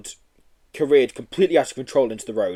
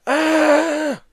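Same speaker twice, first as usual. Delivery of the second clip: high long scream; 4 dB louder than usual. Acted. Boy and Yeti screaming up the ramp, high scream. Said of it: "screamed boy and Yeti".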